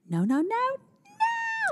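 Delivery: imitating a toddler's long, very high "No, no, no, no"; toddler's high-pitched no. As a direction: stretch each "no" slow and squeaky like a little girl. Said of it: "high-pitched n".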